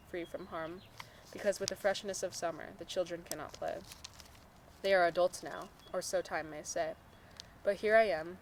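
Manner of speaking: measured poetry recital; poem reading. Acted. Reading own poem to a friend, measured recital. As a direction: read it as verse, line by line.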